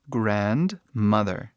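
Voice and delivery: grandmother said slowly in English, GRAND-mother; teacher models grandmother slowly for students; careful English model word. Shown as a demonstration. Teacher to students, slow model pronunciation. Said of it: "que no inglês é uma palavra bem grande".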